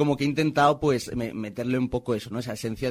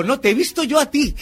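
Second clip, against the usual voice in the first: excited retort; 12 semitones higher, 8 dB louder than usual. Retold or imitated. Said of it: "Y le digo".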